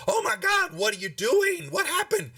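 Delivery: high-pitched alarmed outcry; upset town master. Acted. Town master comes out and finds bodies, high upset cry. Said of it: "he was, he's upset".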